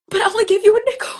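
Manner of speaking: high, fast, loud and choked up; tearful disbelief. Retold or imitated. To the man who blessed her, overwhelmed and tearful. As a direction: blurt it out through rising tears. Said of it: "I was just like".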